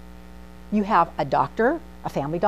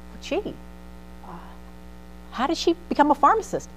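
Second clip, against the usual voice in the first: mock innocent; 7 semitones higher, 3 dB quieter than usual. Feigned innocent question.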